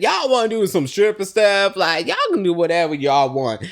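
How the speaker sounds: loud, high-pitched mimicking of a friend; loud mimicry. Acting out Marlo's remark at table.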